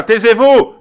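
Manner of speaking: loud, high command to be silent; angry shout. Acted. Viewers rebuke the inventors of lies, shouted order. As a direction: shout it at the liars.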